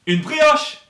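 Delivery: brioche called out loud and high; loud high shout. Shown as a demonstration. Teacher calls it out loud to the class. Repetition drill in varied voices.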